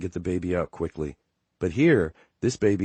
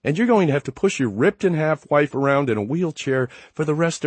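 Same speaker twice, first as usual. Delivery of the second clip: much higher, louder furious rant; angry rant; 9 semitones higher, 5 dB louder than usual. Acted. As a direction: rant at him in pain. Labouring wife rants at husband.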